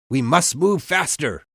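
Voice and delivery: loud, high urgent call; yelling. A narrator's reading. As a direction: urge the crew on at a yell. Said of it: "he yelled".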